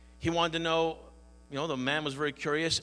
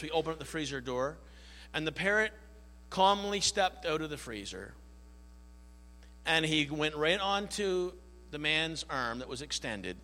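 normal talking voice

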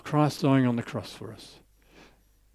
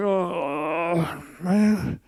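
mock groan, a bit higher, sarcastic; groaning sarcasm; 4 semitones higher, slower than usual. Professor groaning to himself about the old man's story, mock groan. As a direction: grumble it like a trapped listener. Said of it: "the professor's groaning".

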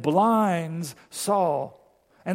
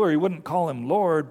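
ordinary talk

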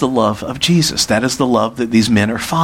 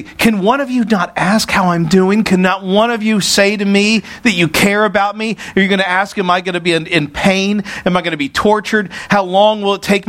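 raised pitch, a lightly pleading appeal for care; pleading plea; 7 semitones higher than usual. Pretend. Preacher voices a broken leader asking his friends for care, lightly pleading. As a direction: ask for comfort, lightly voiced. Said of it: "you would break down".

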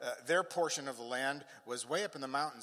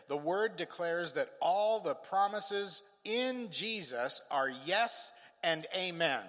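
slow, emphatic preaching; 5 semitones higher, 5 dB louder, slower than usual. Real, not acted. Preacher declaring God's promises to the congregation, slow and emphatic. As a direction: proclaim it, stretching the key words.

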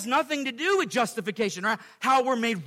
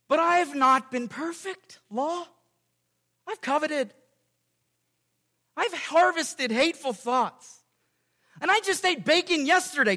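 pleading self; 6 semitones higher than usual. Pretend. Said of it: "So I reply again".

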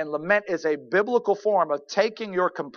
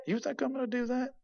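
high, soft, muttered grumbling; muttering grumbler; 5 semitones higher, 8 dB quieter than usual. Shown as a demonstration. Preacher acting out a grumbler, muttering under breath. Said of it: "This is muttering in a low tone".

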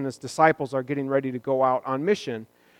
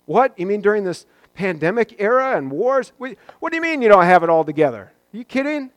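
high, loud mock disbelief "What?"; mock shock; 5 semitones higher, 5 dB louder than usual. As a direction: ham up a shocked friend. Mock shocked friend reaction.

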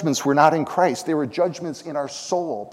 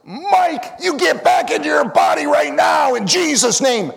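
high, loud re-enacted bishop's command; thundering imitation of a faith command; commanding shout; 12 semitones higher, 8 dB louder, slower than usual. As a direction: shout it like a bishop ordering a man back to life. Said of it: "Bishop runs up there and he says".

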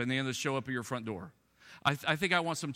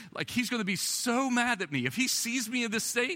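high, louder worried rush; mock panic; 10 semitones higher, 3 dB louder than usual. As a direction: play up the dread of the doctor. Retold panicked thought.

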